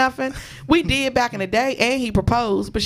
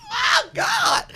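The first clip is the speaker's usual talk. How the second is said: shocked oh God. Retold or imitated. High shocked cry after the girlfriend curses her out. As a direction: react stunned to being cursed out.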